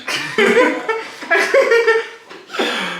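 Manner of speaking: loud high-pitched burst of laughter. Real, not acted. Laughs at own Spanish comment.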